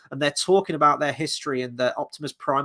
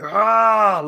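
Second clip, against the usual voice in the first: loud, higher mock villain roar; Scourge; 7 semitones higher, 8 dB louder than usual. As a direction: let out a frustrated cry, imitating the villain. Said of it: "Scourge is like".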